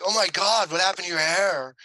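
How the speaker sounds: loud, high-pitched aghast line read; exaggerated shock. Shown as a demonstration. How most students say the audition line, exaggerated shock. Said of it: "Like that, which is whatever".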